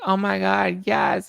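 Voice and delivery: high, bright mock squeal; imitating an excited celebrity. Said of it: "Whitney's like".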